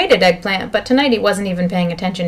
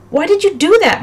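high-pitched cry of protest; loud, high accusing cry; 11 semitones higher, 4 dB louder than usual. Acted. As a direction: cry out in dismay, voice leaping up. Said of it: "Miles cried out".

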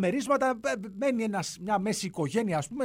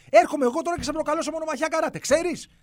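mock challenge; 9 semitones higher, 4 dB louder than usual. Challenging to karate duel.

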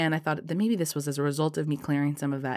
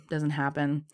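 normal talking voice

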